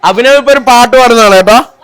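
excited announcement. Real, not acted. Host announcing to audience.

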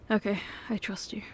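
soft whisper of trust; whispered reassurance. Acted. To the partner, promising to trust them, whispered. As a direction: whisper it, letting it go.

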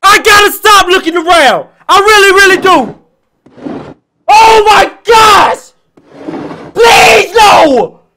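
high, loud screams of fright; panicked shouting. Real, not acted. Streamer regrets looking around, screaming in panic. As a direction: shriek and plead in sheer panic.

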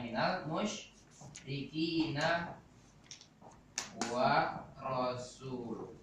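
very slow, soft drawn-out recitation. Shown as a demonstration. Teacher reciting verse slowly for class. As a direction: draw out each word of the verse.

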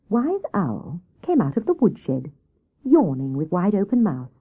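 ordinary delivery

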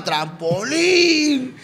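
drawn-out imitation of a shouted request; retold heckler's call. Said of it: "cada canción gritaba".

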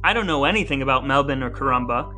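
ordinary delivery

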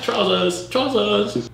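put-on British accent. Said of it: "wrong accent".

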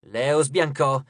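plain reading voice

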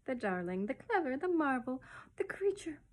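rapturous list, ending high-pitched; gushing aunt. Retold or imitated. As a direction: mimic an aunt gushing over a baby. Baby mimicking gushing aunt.

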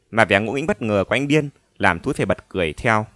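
usual speaking voice

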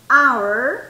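OUR loud, starting on an open ah; vocal inicial. Shown as a demonstration. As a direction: hit the opening vowel of OUR, no h. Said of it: "Es una vocal". (our fuerte, empezando con vocal)

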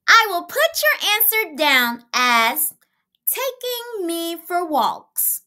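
put-on high girlish voice; little girl voice. A narrator's reading. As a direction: speak like a small cheerful girl. Child voice in story.

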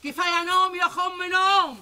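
high, drawn-out holler; scolding, high-pitched yell through to the bedroom; wake-up call. Acted. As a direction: call out to rouse a sleeper. Grandmother calls sleepers to wake up.

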